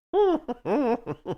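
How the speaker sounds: short amused laugh. Real, not acted. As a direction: laugh in disbelief. Laughing at SEC podcast.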